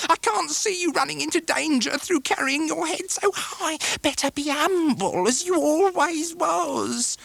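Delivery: fawning plea, slow at first; pleading mother. Acted. Mrs Heep pleads.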